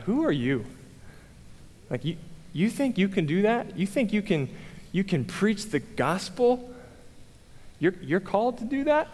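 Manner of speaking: mocking inner critic; doubting self-talk. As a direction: question yourself in disbelief, pitch climbing on each question. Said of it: "this is what I'm, I'm telling myself".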